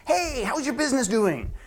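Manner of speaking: high, bright nosy greeting; imitating a friendly relative; chirpy friend asking. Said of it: "a friend or a family member says".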